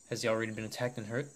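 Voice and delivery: normal talking voice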